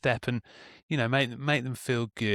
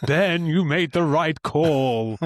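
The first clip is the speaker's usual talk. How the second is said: mock-divine voice, drawn out; voice of a god; 3 semitones higher, 4 dB louder, much slower than usual. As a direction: pronounce it like an approving deity. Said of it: "alcohol-free god saying".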